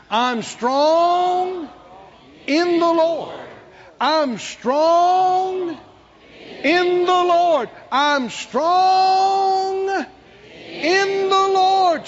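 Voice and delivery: high, loud drawn-out chant building in force; sustained, ringing shout; rallying declaration. Shown as a demonstration. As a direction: lead a crowd in proclaiming it. Leads congregation in building declaration.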